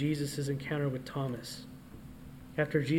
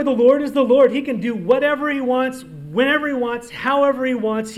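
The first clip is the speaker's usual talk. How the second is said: loud, raised preaching, much louder and higher; full-force preaching; 6 semitones higher, 9 dB louder than usual. Acted. Preacher declaring God's sovereignty to the congregation, loud and raised. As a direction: proclaim it to the whole church, voice ringing.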